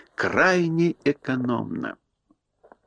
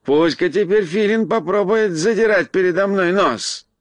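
high, good-natured creature voice; delighted bear; 6 semitones higher than usual. A narrator's reading. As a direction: boast happily in a high voice, drawing out the new name. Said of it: "воскликнул он". (высокий голос довольного простодушного медведя)

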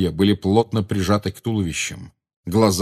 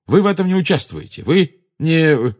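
angry, raised-voice prohibition; forceful order, higher in pitch; infuriated; 8 semitones higher, 5 dB louder than usual. A narrator's reading. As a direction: forbid them heatedly. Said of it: "снова разъярился Гарри".

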